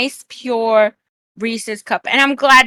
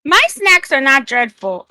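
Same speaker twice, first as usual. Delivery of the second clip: ordinary talk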